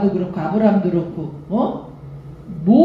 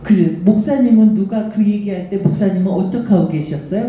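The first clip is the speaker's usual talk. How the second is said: normal talking voice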